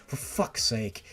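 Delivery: ordinary delivery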